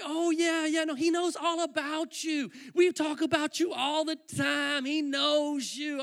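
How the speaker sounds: high, loud, stretched-out words; imitating over-enthusiastic friends; effusive reassurance. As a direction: gush slowly to convince a friend. Friends reassuring him effusively, retold.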